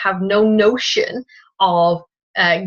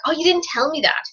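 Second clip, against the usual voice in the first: high exasperated protest; frustrated protest; 9 semitones higher than usual. Retold or imitated. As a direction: let the frustration spike up. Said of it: "I get really frustrated. I'm like".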